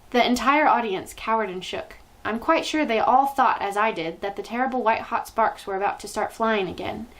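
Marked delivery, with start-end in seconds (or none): none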